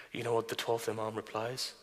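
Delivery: ordinary talk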